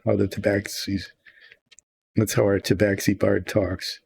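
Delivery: ordinary talk, nothing marked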